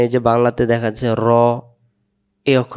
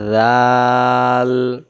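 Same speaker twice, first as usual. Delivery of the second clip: slow model recitation of জাল; long drawn-out letter name; much slower than usual. Shown as a demonstration. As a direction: hold জাল out slowly like a teacher. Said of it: "নরম করে পড়তে হয়".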